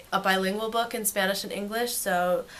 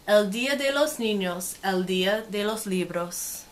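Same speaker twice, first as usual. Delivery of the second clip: lively, sing-song storybook read; reading to children; 2 semitones higher, 5 dB louder than usual. Acted. Teacher reading a picture book to kids, lively and sing-song. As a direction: make the page sparkle for little listeners.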